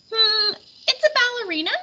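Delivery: high, fancy put-on voice for the taxicab couple; a posh lady musing over the snow sculpture; voiced couple. A narrator's reading. Taxicab couple musing about the sculpture, high put-on voice.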